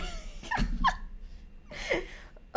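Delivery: long helpless high laugh; high cackling laugh. Real, not acted. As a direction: burst into laughter, then fade. Cracking up at own joke.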